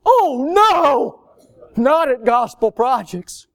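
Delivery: high, loud mock alarm, joking; mock horror. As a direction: fake shock to tease the messenger. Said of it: "trying to make a joke".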